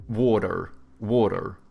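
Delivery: American water, R pronounced; American accent. Shown as a demonstration. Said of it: "so they go like".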